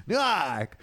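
hammed-up Newark accent; made-up Newark accent. Shown as a demonstration. Host joining the accent joke, mock Newark accent. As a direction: draw out Newark in an invented accent. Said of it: "just make an accent up".

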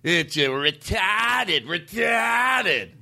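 put-on drawn-out Boston accent, rising on the repeat; thick Boston accent. Host mocking how that accent says the word, thick Boston accent.

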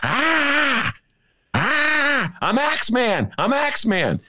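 high, manic yelling; hammed-up manic chant; Jack Nicholson psyching up. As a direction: pump yourself up like a crazed axeman. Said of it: "he was jumping up and down".

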